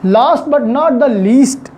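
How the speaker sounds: usual speaking voice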